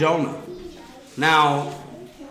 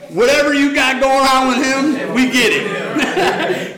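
higher, slow, laughing shrug; mock resigned sailors; 8 semitones higher, 5 dB louder, slower than usual. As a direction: toss him over with a laugh. Sailors hand Jonah over to God.

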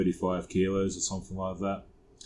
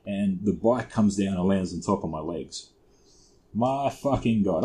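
usual speaking voice

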